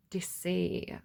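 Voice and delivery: breathy dramatic whisper of deceit; whispered ominous word. Acted. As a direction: hush it like a secret. Reading card, dramatic hush.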